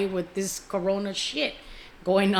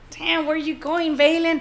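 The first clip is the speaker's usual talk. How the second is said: high-pitched teasing query, louder on the name; impressed surprise; 8 semitones higher, 5 dB louder than usual. Retold or imitated. Mother asks her nicely scented son where he's going, impressed. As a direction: ask it high, impressed and curious.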